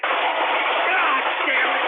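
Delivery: a slow, stretched-out howl of rage; drawn-out anguished cry. Acted. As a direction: wail it out in frustration. Host enraged at Alex Jones.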